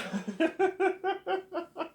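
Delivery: high cackle; high laugh. Real, not acted. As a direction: laugh hard.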